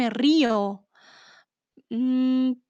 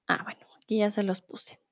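whispered aside; 3 semitones lower, 7 dB quieter than usual. Real, not acted. Aside while checking the screen.

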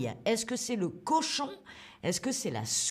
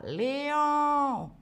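long, held high call of Léon!; a drawn-out peacock screech on Léon!; peacock cry; 4 semitones higher than usual. Shown as a demonstration. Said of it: "c'est à peu près comme ça".

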